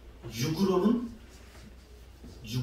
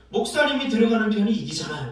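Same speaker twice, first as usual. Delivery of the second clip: lightly imitated child, higher and louder; child backing up; 5 semitones higher, 8 dB louder than usual. Child backing sister about pastor's football, childlike insistence. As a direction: chime in like a confident kid. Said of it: "이니가 뭐라 그러냐면".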